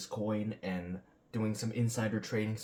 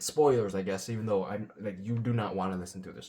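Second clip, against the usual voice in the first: usual speaking voice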